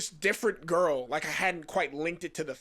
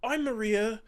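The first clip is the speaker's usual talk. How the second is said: put-on British accent, slow and higher; 7 semitones higher, slower than usual. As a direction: imitate her drawn-out, higher fake British. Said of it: "she's like, ... are you from the U K".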